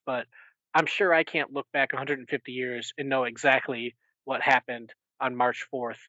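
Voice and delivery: ordinary delivery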